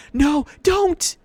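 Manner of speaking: piercing high scream. A narrator's reading. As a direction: scream to stop him. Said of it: "Annabeth screamed".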